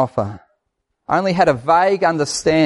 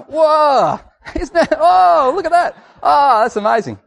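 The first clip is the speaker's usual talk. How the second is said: very high, hammed-up awe; drawn-out mock wonder, voice soaring; imitating amazed radio bloke; 11 semitones higher, 3 dB louder than usual. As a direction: gush over fireworks as a dazzled commentator. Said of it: "listening to this bloke going".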